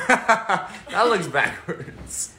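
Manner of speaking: slow words through a laugh. Real, not acted. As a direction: laugh it out slowly. Laughing at backwards writing.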